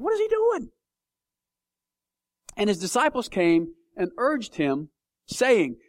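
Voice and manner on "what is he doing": much higher, baffled; mock disbelief, voice shooting up; bewildered. Preacher recalling his confusion, higher and baffled. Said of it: "made me think".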